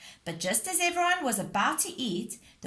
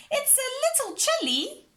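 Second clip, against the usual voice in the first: shivering; 3 dB louder than usual. Acted. After diving into cold sea.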